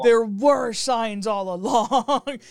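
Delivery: joking conspiracy-theorist outburst; mock-dramatic revelation. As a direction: proclaim it slowly, laughing at the drama. Mock-dramatic echo of co-host's revelation.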